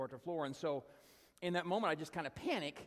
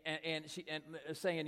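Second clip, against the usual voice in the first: ordinary delivery, nothing marked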